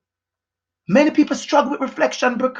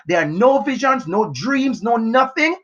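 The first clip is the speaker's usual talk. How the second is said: ordinary talk, nothing marked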